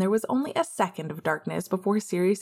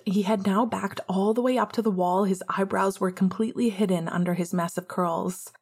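plain reading voice

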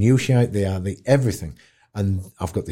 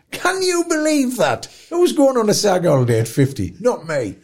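mock disbelief; 11 semitones higher than usual. Real, not acted. Mock outrage at Saga age.

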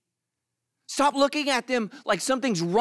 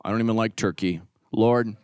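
much lower, grudging mumble; reluctant pray-er; 11 semitones lower than usual. Retold or imitated. Reluctant guest saying Thanksgiving grace, low grudging mumble. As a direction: drag yourself through the prayer. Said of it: "You're like".

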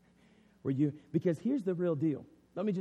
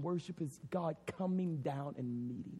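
whisper; 7 dB quieter than usual. Real, not acted. Closing line whispered.

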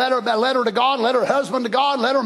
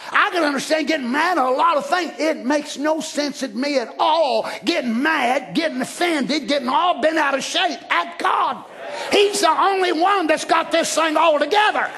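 raised voice, key words drawn out slowly; full-force preaching; 6 semitones higher than usual. Real, not acted. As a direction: build to an incredulous rebuke from the pulpit.

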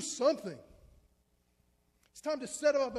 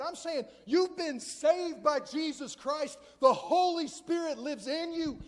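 ordinary talk

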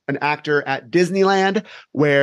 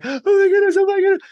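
much higher, louder panicky squeal; panicked friend; 4 dB louder, faster than usual. Retold or imitated. Frightened friend beside a girl just jumped, high panicky squeal. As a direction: squeak it out like a frantic schoolgirl. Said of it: "her friend's like".

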